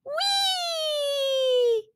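long, high, joyful whee; gleeful whee. Acted. Rossi diving in for home, long high joyful whee. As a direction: sing out with delight while diving.

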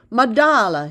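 loud call across the yard, falling. A narrator's reading. Old man calling the toddler over, loud. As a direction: call Madala out loud to the boy. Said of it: "he called again".

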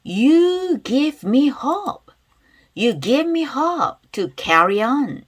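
loud, slow and drawn-out; loud pronunciation demo. Shown as a demonstration. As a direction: model each phrase loudly for learners to repeat. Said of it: "큰 소리 따라볼게". (큰 소리로 천천히 따라 읽기 시범)

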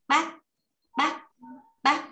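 "bác" said short with a heavy, stopped final c. Shown as a demonstration. Teacher drills a syllable for learners, "bác" short and heavy. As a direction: cut "bác" off hard on the final c. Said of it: "đọc nặng nữa".

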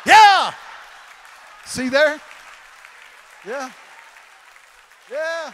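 very loud, high exultant yeah; rally the crowd; triumphant cheer. Real, not acted. Cheering the audience.

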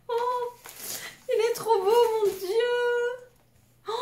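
very high, almost tearful squeal; overwhelmed delight. Real, not acted. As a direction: squeak it out, overcome with joy. Overcome by a beautiful gift. (émerveillement suraigu, presque en larmes)

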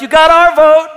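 loud, high-pitched mock crowd reply; rally-goers' cheer. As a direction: call it out like a won-over voter. Crowd won over at rally.

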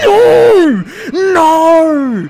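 loud, high wailing shriek; real jump-scare howl; terrified scream. Real, not acted. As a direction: scream it out, then whimper high.

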